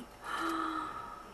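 breathy amazed gasp; soft gasp. Real, not acted. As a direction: catch your breath at the sight. Amazed by a gift.

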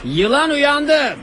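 slow, loud, high dramatic line; dramatic announcer. Acted. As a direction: boom it like a trailer. Dramatic narration, laughing shout.

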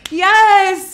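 squealing laugh, then a loud high yes; delighted shriek. Real, not acted. Guest thrilled by the host's surprise drink, shrieks yes. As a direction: burst out laughing with joy at the surprise.

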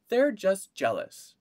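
mother waving it away, high and loud; high dismissive mother. A narrator's reading. Mother dismissing daughter's worry, high and loud. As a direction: brush it off as mother. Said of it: "said her mother".

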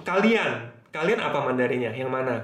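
normal talking voice

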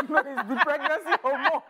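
high, loud laughter through the words; can't stop laughing while teasing; cackling at the pregnant fall story; laughing hard. Real, not acted.

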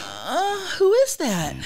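high, bewildered falsetto; Greg. Acted. Character asking who is there, high and baffled. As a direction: question it in a high put-on voice.

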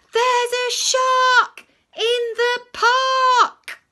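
very high, loud, slow scream; full yell. Acted. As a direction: yell the alarm with all your might. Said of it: "Timothy yells with all his might".

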